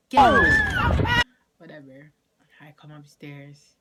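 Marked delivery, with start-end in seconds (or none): 0.1-1.2 very high, very loud mock-mom scream; imitating screaming mom. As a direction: blow up into a full shriek as mom. Mom's order repeated as scream.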